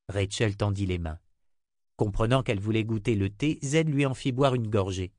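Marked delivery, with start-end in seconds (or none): none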